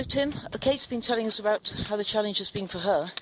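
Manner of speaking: ordinary talk, nothing marked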